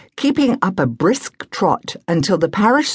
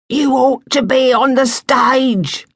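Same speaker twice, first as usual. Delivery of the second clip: put-on old woman's wheeze; 9 semitones higher, 3 dB louder than usual. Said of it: "wheezed old Missus Jenkins".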